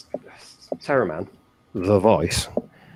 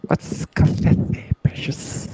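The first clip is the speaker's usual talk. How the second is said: slow whispery creature hiss; Gollum voice; much slower than usual. Acted. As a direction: creep out the words like a cave creature. Playful Gollum-style creature voice.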